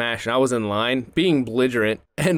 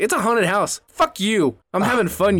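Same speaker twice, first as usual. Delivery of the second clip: excited retort; 9 semitones higher, 3 dB louder than usual. Retold or imitated. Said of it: "And I'm like".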